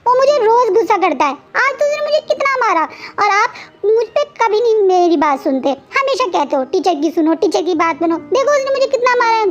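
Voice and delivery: put-on high, tearful complaining child voice; whiny upset child. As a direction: sulk and accuse like a hurt kid. Child Wing complains angrily to Wang. (रूठा हुआ रोता बच्चा)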